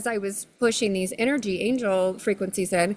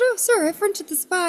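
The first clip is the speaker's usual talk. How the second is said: high quoted self; 11 semitones higher than usual. Retold or imitated. Her own quoted reply.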